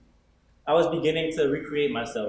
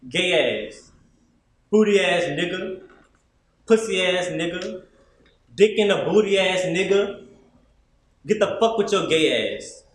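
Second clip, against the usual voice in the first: loud jeers in a raised voice; imitated yelling; 5 semitones higher, 5 dB louder than usual. Other kids hurling insults as he walks by, imitated yelling. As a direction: yell each insult like jeering schoolkids. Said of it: "the other kids yelling out".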